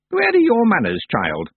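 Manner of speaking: put-on high, loud and proud old woman; old witch proudly pleased with her trick. A narrator's reading. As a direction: boast like a mischievous crone. Miss Treason scolding Tiffany.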